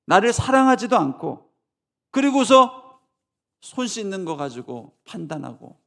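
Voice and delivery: fiery preaching rebuke; Jesus rebuking. Acted. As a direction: thunder at the Pharisees like Jesus.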